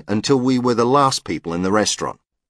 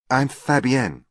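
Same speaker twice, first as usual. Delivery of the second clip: put-on higher French-accented introduction; 6 semitones higher, slower than usual. A narrator's reading. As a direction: introduce yourself as a Frenchwoman would. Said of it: "in an attractive French accent".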